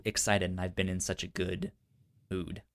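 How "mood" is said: ordinary talk, nothing marked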